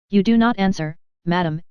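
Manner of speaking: ordinary delivery, nothing marked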